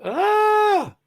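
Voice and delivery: sudden high yelp of frustration; frustrated shout. Real, not acted. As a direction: blurt out a loud annoyed cry. Frustrated cry while searching.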